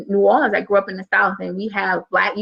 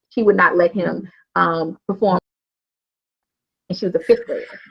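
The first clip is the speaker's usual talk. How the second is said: ordinary talk, nothing marked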